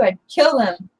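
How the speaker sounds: normal talking voice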